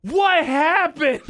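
high, slow fake innocence; pretending he wasn't there; fake innocent. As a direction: play dumb in a high, drawn-out voice. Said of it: "you hear me go".

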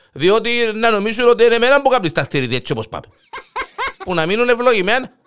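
loud, high, fed-up yelling; exasperated shout. Acted. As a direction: blow up at the neighbour. Kokkos loses patience, shouting. (εκνευρισμένη φωνή, τσιρίζει)

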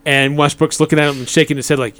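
ordinary talk, nothing marked

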